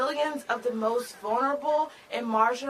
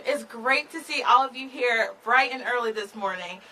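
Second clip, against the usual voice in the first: normal talking voice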